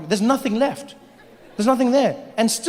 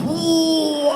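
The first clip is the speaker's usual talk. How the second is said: ordinary talk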